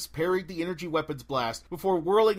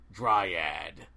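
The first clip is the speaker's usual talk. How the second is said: lower, curt otherworldly answer; dryad voice; 10 semitones lower than usual. Acted. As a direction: correct her flatly, ancient and cold. The Dryad names herself.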